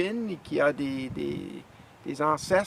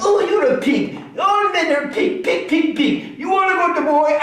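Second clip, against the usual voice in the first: high mock wife voice, louder; scornful wife; 13 semitones higher, 11 dB louder than usual. Husband playing his wife calling all men pigs, high mock voice. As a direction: sneer out pig as the wife.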